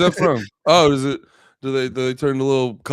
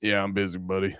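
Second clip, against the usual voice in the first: lower, softer, lazy cop voice; lazy cop brush-off; 5 semitones lower, 6 dB quieter than usual. Retold or imitated. Bored cop brushing off the crowd, lower and softer. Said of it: "then they go".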